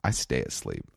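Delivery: deep, quiet mock infomercial announcer. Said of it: "you sound like a infomercial".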